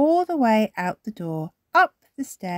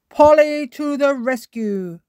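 higher, loud cheer; grandpa cheering; 9 semitones higher, 9 dB louder than usual. A narrator's reading. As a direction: cheer the parrot on. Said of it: "cries Grandpa Pig".

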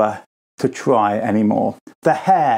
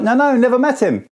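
nervous denial; 9 semitones higher than usual. Acted. Peter denies again.

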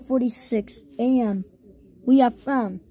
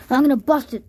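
usual speaking voice

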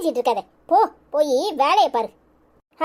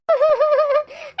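put-on child's giggle; 8 semitones higher than usual. Child playing salt-bag game.

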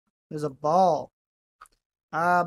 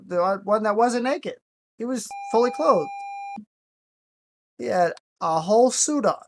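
ordinary talk, nothing marked

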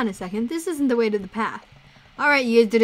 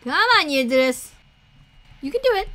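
louder urging call; raised, coaxing shout; 5 semitones higher, 6 dB louder than usual. Pretend. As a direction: holler to a straggler to keep up. Calling to lagging companion.

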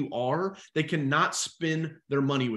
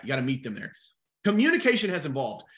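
ordinary talk, nothing marked

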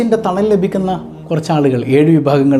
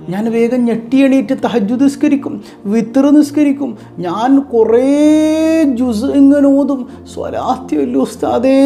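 high, plaintive mock-pious mother; imitating tearful mother; 6 semitones higher than usual. Mother telling the ustad of her prayers, imitated high whine. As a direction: mimic a mother's high-pitched lament. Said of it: "എന്നിട്ട് അങ്ങോട്ട് കരയാ".

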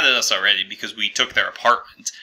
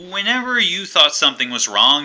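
ordinary delivery

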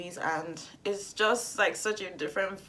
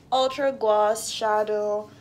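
normal talking voice

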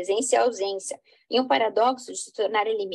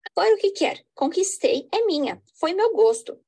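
raised, higher pitch, a heated outburst; angry claim; 6 semitones higher, 4 dB louder than usual. Acted. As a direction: bark out the claim to the rival. Rival claims woman, threatens.

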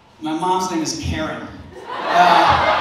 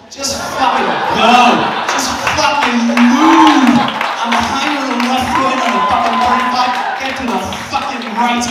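road-rage rant; 3 semitones higher, 8 dB louder than usual. Acted. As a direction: yell at the driver in front to move. Comic rant at slow drivers.

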